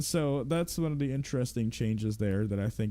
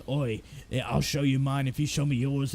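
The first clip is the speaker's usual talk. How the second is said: cheeky Barty Crouch. Retold or imitated. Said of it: "he makes a dick joke where he's like".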